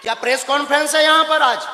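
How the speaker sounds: fast, high, loud shout; angry shout. Real, not acted. Guru shouting at the reporter that this is no press conference. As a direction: shout him down.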